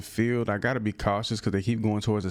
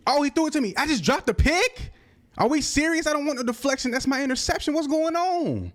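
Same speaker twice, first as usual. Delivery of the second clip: much higher and louder, disbelieving cries; real frustrated outburst; 3 dB louder than usual. Real, not acted. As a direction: yell at the game after a dropped pick. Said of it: "I'm a little upset".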